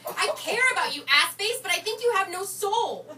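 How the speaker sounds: loud, high-pitched outburst; bursting out. Acted. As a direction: blurt it out at full volume, voice shooting up. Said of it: "She never bursts out and says".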